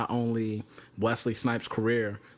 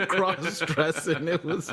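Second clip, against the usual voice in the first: high, laughing and stretched out; 6 semitones higher, slower than usual. Real, not acted. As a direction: laugh through the words. Laughing at his childhood memory.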